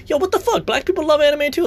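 high-pitched disbelief; real shocked indignation; incredulous outrage. Real, not acted. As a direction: shriek it like you can't believe it. Outraged at racist gatekeeping.

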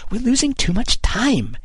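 very high, strained aside; urgent whisper. A narrator's reading. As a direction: fret in a high, hushed-style voice. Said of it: "he whispered to Kidd".

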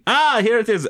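high mock French-waiter voice; French maître d'. Maître d' finding the booking, mock French accent. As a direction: exclaim with a put-on French accent.

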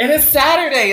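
high excited announcement. Real, not acted. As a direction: sing out the day.

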